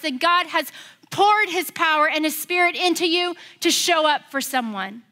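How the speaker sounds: loud, high sermon voice; preaching. Acted. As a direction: drive it home to the congregation. Preaching at full force.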